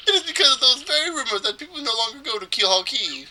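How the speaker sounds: high-pitched, theatrical explanation; fey trader. Acted. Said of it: "make this guy sound as fey as possible".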